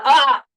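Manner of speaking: sudden loud, high "ah!"; loud exclamation. Real, not acted. Host reacting to a guest's burnout, loud high cry. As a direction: burst out with a shout.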